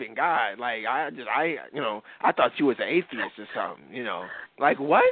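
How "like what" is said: much higher, louder disbelieving "what?"; as if you can't believe what you heard; incredulous. Real, not acted. Reacting to atheist claim.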